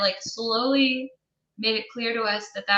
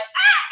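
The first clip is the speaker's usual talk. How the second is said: mock scream; 4 dB louder than usual. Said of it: "then you hear her, like".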